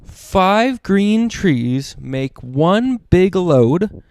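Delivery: ordinary delivery, nothing marked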